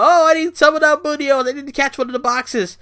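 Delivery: hammed-up cheesy announcer voice; mocking Byron Saxton. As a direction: squeal it like a dopey commentator. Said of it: "fucking Byron Saxton".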